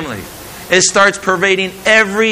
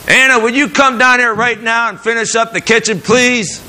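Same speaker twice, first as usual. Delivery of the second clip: bright, high, kind asking voice; gentle request; 6 semitones higher than usual. Shown as a demonstration. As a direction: call her down sweetly and politely. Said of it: "There's a difference there".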